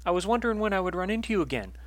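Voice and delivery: high-pitched, hesitant woman's voice; Martine. Acted. Martine asks Essie for time to talk, high hesitant character voice. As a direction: raise the voice, tentatively asking a favour. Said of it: "my voice is gonna be all over the place for her".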